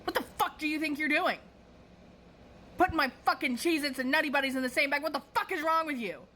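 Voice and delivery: high, quick mock yell of an irate customer; hammed-up, high-pitched indignation; retold angry shouting. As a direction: imitate an outraged customer telling someone off. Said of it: "shouts at me".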